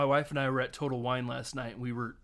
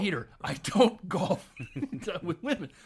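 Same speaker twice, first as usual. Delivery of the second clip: mock outrage; 4 semitones higher than usual. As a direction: refuse it with hammed-up outrage. Acted refusal, shouted.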